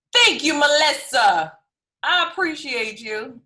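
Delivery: bright enthusiastic thanks; excited. Real, not acted. Thanking viewer gleefully.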